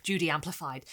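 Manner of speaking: usual speaking voice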